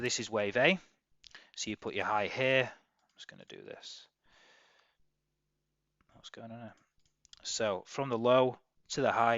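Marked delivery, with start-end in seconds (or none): 2.6-6.7 very soft, under-the-breath aside; muttering to self. Real, not acted. Trader checking his chart, very quiet self-talk. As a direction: think aloud quietly, barely projecting. Talking to himself while charting.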